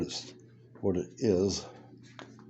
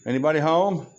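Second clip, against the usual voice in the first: calling down a hallway; 9 semitones higher than usual. Real, not acted. Calling out to empty shop.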